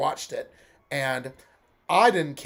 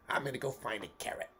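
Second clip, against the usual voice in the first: Bugs Bunny voice; 9 dB quieter than usual. Acted. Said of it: "going for a Bugs Bunny sort of sound".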